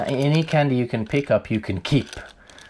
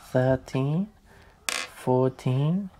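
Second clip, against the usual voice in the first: ordinary talk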